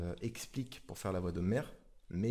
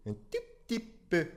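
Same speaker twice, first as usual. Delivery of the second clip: higher, nasal Homer pitch; slightly squeaky cartoon voice; a little high-pitched; 6 semitones higher than usual. Shown as a demonstration. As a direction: lift the voice a notch. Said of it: "un petit truc un tout petit peu aigu".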